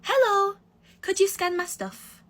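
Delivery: slow, sweet, proper request; polite example. Retold or imitated. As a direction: show how nicely it should be asked. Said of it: "why didn't you just say".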